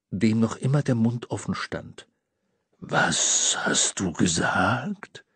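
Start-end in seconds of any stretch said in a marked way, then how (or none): 2.8-5.2 low, slow, resonant Father Christmas; deep Santa voice. A narrator's reading. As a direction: rumble it like Santa. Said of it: "mit klangvoller, tiefer Stimme".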